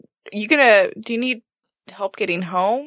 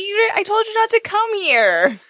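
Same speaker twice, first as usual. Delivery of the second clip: embarrassed scolding; 12 semitones higher than usual. Acted. Joe scolds friend, embarrassed.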